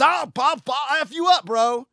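high, mocking tough-guy threat; mock road rager. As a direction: ham up a petty driver squaring up. Mocking a slighted driver's threat.

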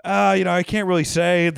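high, loud dodging cop; cagey, loud cop dodge; evasive cop. Retold or imitated.

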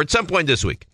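normal talking voice

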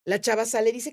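usual speaking voice

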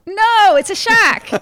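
put-on loud, high, jokey Boston accent on "shack" for shark; Boston accent punchline. Telling her old Boston joke, she yells the punchline in a mock Boston accent. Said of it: "we used to have this joke we would say".

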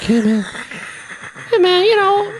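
squeaky high laid-back stoner; helium-high chill dude; high-pitched stoner voice. Retold or imitated. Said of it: "That's what I sound like".

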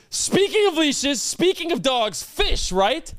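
high, slow mocking repeat of his friend; mocking imitation. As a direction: parrot his words back in a high, slow, teasing voice. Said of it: "we're playing I Spy with words".

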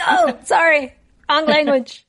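catching herself for speaking the wrong language, sheepishly; sheepish apology. Retold or imitated. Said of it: "I'm like".

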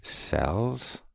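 tentative answer with rising pitch. A narrator's reading. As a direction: offer it as an unsure guess. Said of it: "I replied less confidently".

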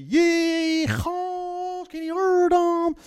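high, loud, drawn-out Leiden shout; singsong Leiden accent at full volume; broad Leiden accent. Acted. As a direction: holler it in caricature Leiden dialect. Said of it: "het echt Leids accent is van".

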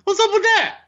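very high mock North Carolina accent. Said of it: "a North Carolina accent right there".

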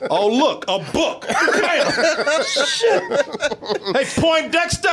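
put-on schoolyard taunting; mock bully. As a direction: play a bully jeering at a nerd. Plays bully mocking the bookish friend.

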